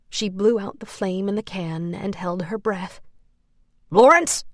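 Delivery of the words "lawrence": higher, louder yell rising; yelled name. A narrator's reading. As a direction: call him out loudly. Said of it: "a man yelled".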